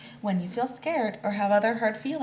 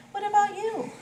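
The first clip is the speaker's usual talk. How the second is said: high questioning voice; 14 semitones higher, 5 dB louder than usual. Acted. Narrator asks dog, high.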